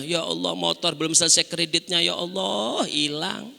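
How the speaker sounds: a hint of a grieving mother; mock lament. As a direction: sigh out the loss like a worried mother. Acted lament over stolen motorbike.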